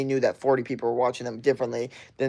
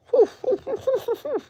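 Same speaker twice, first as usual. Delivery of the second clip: whew. Real, not acted. Blowing off steam after rant.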